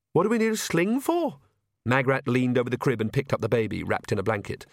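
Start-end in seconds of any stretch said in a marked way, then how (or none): 0.1-1.4 high-pitched puzzled question; incredulous young witch; baffled. Acted. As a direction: question the odd order, voice lifted. Baffled by orders to pack.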